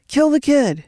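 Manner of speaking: high, loud barked command; shouted order. A narrator's reading. The boss shouts at C J, high and loud. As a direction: yell the order to kill. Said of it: "he shouted to C J".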